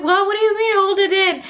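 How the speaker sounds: high, indignant re-enactment; retold incredulous protest. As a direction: act out disbelief at the nurse.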